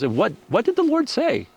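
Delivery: knowing challenge. Retold or imitated. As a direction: voice the nurse calling his bluff. Nurse challenges the retold couple.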